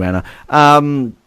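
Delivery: ordinary talk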